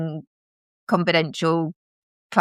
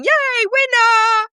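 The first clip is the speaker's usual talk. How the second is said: very high, slow, loud cartoon cheer; mock Trump cheer; 4 dB louder, slower than usual. Trump cheering after his bed bet, a very high, loud mock squeal. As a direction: squeal it like a giddy kid winning a bet.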